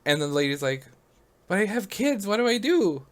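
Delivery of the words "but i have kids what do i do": high-pitched imitation of an anxious caller; worried mother. Mother asking the operator for help, mock worried.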